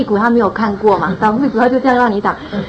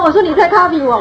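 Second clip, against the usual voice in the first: a laugh running into the words, pitched higher; laughing through words; 7 semitones higher than usual. Real, not acted. Mother laughs as she quotes catching her son copying, higher. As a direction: giggle while you quote.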